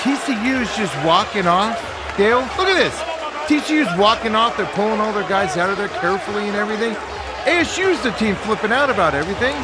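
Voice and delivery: talking over the clip to Dale, excited and loud. Real, not acted. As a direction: call it out like you can't believe it.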